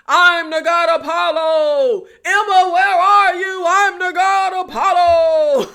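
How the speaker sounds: Apollo. Retold or imitated. Mocking the hero running off towards the building, mock screaming. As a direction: holler it out, drawn-out and hammed-up. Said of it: "screaming the name Emma at the top of his lungs".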